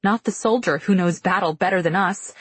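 ordinary delivery, nothing marked

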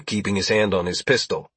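ordinary delivery, nothing marked

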